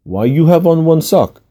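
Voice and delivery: put-on puzzled question in a Russian accent. A narrator's reading. Masseuse questioning her client about his sock, Russian accent. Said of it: "said in her Russian accent".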